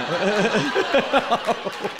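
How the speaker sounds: bursting laugh; loud laugh. Real, not acted. Laughs after drill story.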